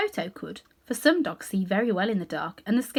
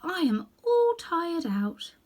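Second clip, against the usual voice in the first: put-on slow, higher, worn-out girl; tired child; 9 semitones higher, much slower than usual. A narrator's reading. Dorothy answers the scarecrow, slow and tired. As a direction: drag each word, weary. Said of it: "answered the child".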